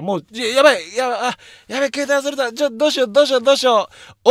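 high-pitched, flustered mock panic; re-enacted panic. Actor finds his prop phone missing onstage, acted panic. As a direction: act out a rising panic, stumbling and repeating.

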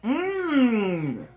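muffled hum through closed lips; savouring food while chewing; mouth full, chewing. Real, not acted. Seen 1: Bites burger at -3s, cheeks full and chewing during "Mmm.

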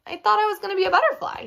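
high, quick, bewildered frog; puzzled. A narrator's reading. Frog expected to be a butterfly, puzzled. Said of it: "the frog was puzzled".

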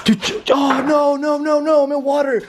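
real alarm at wet feet; panicking. Real, not acted. Ghost hunter steps into flooded basement, high panic.

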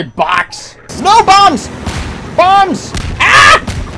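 high, loud cries of alarm; alarmed shout. Real, not acted. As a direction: yell a warning in panic. Bombs thrown at the player.

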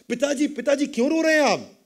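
calling out. Acted. Son runs calling his father.

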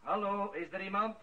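calling out. Acted. Priest to an unseen intruder, calling out warily. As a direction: call down the stairs, wary. Priest calls into dark house.

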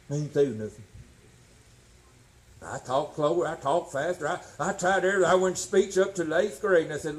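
normal talking voice

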